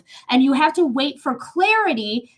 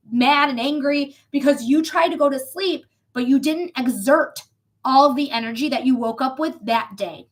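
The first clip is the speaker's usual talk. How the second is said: ordinary delivery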